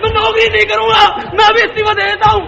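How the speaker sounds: furious shout. Acted. Fed-up clerk quits, shouting in fury. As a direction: rage and quit on the spot.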